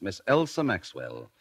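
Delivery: ordinary talk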